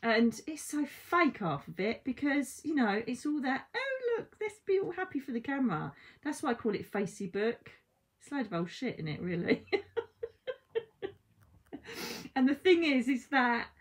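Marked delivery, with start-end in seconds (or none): 3.8-5.9 mock fake cheer. Mocking fake Facebook posts. 9.7-10.5 laugh. Real, not acted. Laughing at her own joke.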